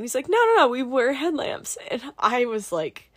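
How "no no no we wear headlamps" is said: high, loud mock brush-off; emphatic dismissal. Instructor waving off question about another plane, high emphatic imitation. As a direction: imitate an insistent correction, pitched way up.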